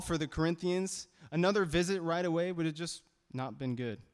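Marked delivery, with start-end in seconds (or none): none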